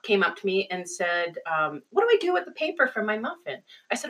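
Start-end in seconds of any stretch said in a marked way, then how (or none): 1.9-3.6 high, quick little-kid imitation; kindergartner's voice. Kindergarten kid asking the substitute teacher, high quick voice. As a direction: put on a high, hurried child's voice. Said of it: "this kid come up".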